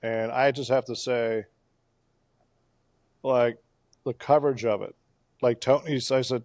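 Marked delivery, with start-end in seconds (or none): none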